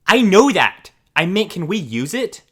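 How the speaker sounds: snippy retort. Retold or imitated. Entitled mom snapping back.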